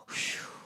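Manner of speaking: soft breathy sigh of relief; imitated sigh of relief; relieved exhale. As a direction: let the worry go out in one breath. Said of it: "they'll go".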